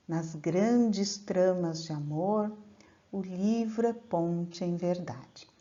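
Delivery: measured, unhurried verse reading; poem recitation. Acted. Teacher recites a trova to the class, measured and poetic. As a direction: declaim the trova with care.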